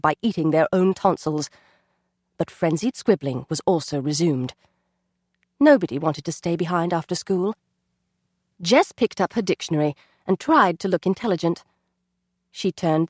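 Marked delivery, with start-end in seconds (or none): none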